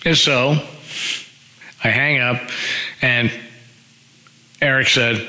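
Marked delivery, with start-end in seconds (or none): none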